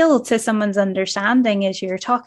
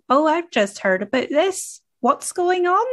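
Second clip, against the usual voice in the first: mock curious question; 7 semitones higher than usual. Acting someone curious asking.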